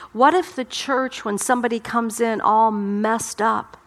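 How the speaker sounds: normal talking voice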